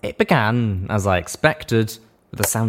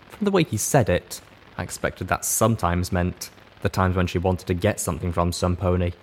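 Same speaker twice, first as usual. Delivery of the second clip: usual speaking voice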